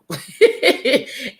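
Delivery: high, loud run of giggles; real laugh. Real, not acted. Speaker after asking the Lord for help, laughing. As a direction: crack up after your own prayer.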